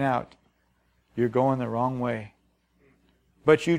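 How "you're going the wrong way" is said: low, soft voice from inside; hushed inner warning. Retold or imitated. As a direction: warn them quietly, like an inner voice. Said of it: "something on the inside is screaming".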